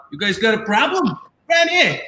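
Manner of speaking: a hint of a gold-chain tough guy, rising to a high yelp; lightly hammed-up macho challenge; mock tough guy. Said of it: "Guy who should have chest hair and a large chain accent".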